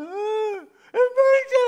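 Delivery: high-pitched wailing cry, hammed-up; mock crying. Shown as a demonstration. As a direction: fake sobbing while crying out for mercy. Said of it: "umiiyak ka, tapos". (kunwaring umiiyak habang sumisigaw, mataas ang boses)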